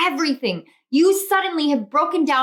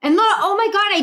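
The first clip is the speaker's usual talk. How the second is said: higher and louder, thrilled; excited exclamation; 7 semitones higher, 4 dB louder than usual. Real, not acted. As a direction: blurt it out in delight. Excited at the card.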